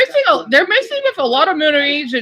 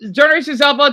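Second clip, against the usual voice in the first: normal talking voice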